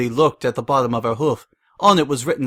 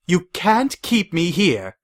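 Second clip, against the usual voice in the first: higher, slow, indignant protest; angry; 7 semitones higher, slower than usual. A narrator's reading. Mayor protesting her arrest, slow and angry. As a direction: spit out each word. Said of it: "the mayor said angrily".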